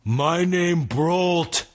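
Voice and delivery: very slow dim giant voice. Acted. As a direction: plod each word like a dumb giant. Giant speaks.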